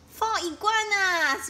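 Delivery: high, loud, slow cry of joy; rejoicing. A narrator's reading. Flocky celebrating his iguana count, high joyful cry. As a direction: cheer it out in delight. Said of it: "rejoiced Flocky".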